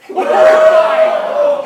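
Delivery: long, high, loud stretched shout; drawn-out shout. Acted. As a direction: hold it out and yell. Stretched shout then repeat.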